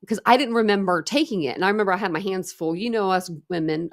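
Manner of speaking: ordinary talk, nothing marked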